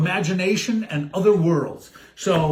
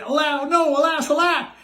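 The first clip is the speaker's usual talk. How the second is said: hammed-up prince cry, higher; mock cry; 9 semitones higher than usual. Prince cries out as Cindy flees, hammed-up. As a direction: alas alack, wailed high. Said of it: "The prince cried".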